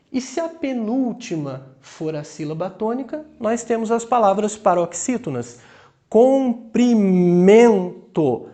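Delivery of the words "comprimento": Comprimento very slow, MEN loud and long; stress on penultimate syllable. Shown as a demonstration. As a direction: split Comprimento and punch MEN. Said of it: "Vejam a intensidade".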